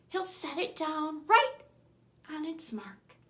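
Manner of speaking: usual speaking voice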